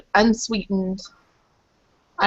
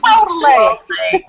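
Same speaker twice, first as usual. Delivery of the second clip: excited; 8 semitones higher, 6 dB louder, much slower than usual. Real, not acted. Laughing agreement over the pronunciation.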